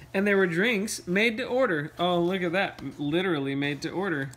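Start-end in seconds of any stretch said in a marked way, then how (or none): none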